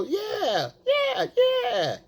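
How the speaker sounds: squealed mock agreement, very high; matching her energy. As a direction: climb into a silly falsetto cheer. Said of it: "I'm gonna match her energy".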